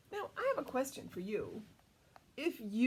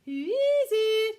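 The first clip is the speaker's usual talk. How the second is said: put-on wolf's sugary old granny voice, higher and slow; sweetest granny voice; 10 semitones higher, 11 dB louder, slower than usual. A narrator's reading. Wolf in Granny's bed calling to the door, sweet granny voice. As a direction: coo it like a sweet old grandma. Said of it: "in his sweetest granny voice".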